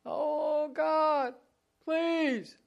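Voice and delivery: high, drawn-out pretend pleading; mock begging. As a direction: plead like a whiny, hammed-up supplicant. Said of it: "begging and pleading".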